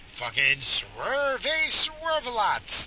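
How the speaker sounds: slow, high taunting call. Real, not acted. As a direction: taunt the swerving driver. Calling out the swerving driver.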